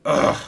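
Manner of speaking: loud exasperated groan; frustrated engine groaning loudly. A narrator's reading. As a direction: let out a fed-up groan. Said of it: "groaned Stephen".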